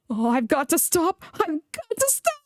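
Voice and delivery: straining to stop; groaning. A narrator's reading. James fighting the runaway trucks, strained cry. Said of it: "groaned James".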